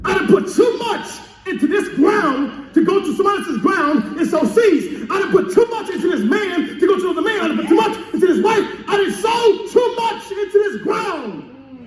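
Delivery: high-pitched, emphatic preacher acting out refusal; impassioned farmer's protest. Preacher voicing a farmer who won't leave his ground, impassioned protest. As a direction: dig in, stretching the key words high.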